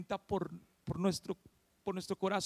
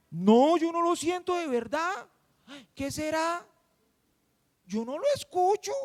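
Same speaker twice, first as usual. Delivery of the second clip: higher, questioning reply of a doubter; listener's doubtful reply; 5 semitones higher than usual. Acted. Preacher voices the listener's likely answer, puzzled. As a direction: voice a puzzled believer, pitched up. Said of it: "tu respuesta sea".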